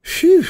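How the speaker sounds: big high exhale of relief, loud; sudden loud phew of relief after a close call; relieved phew. Acted. Relief after rescuing the oven.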